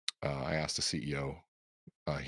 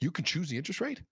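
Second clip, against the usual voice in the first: much higher, incredulous rise on rate; a newcomer amazed at the mortgage trade; amazed question; 12 semitones higher than usual. Retold or imitated. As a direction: sound stunned that you can pick the rate. Learns rates can be chosen.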